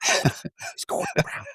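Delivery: silent mouthed words; mouthing the name to his wife, voiceless. Retold or imitated. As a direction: mouth it without voice across the kitchen. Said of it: "I'm trying to mouth".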